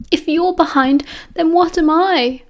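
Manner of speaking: high, whiny wail of self-pity. A narrator's reading. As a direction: wail it like the worst student in the room. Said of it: "Peter wailed from the floor".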